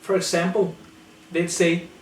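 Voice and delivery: ordinary talk, nothing marked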